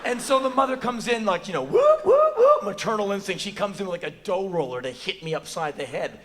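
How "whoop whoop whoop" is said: high, loud siren-like whoops; whooping alarm. Retold or imitated. Mother's instinct kicks in, loud high whooping.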